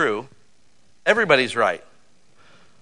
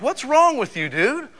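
raised, higher scolding voice; scolding adult; 9 semitones higher, slower than usual. Retold or imitated. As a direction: scold the kid, higher than usual. Teacher scolding a kid.

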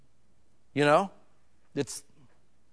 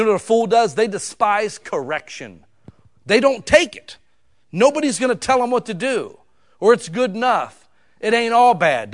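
loud, higher, driving sermon peak; loud, higher preaching; preaching at full force; 6 semitones higher, 6 dB louder than usual. Real, not acted. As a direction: hammer it home to the congregation.

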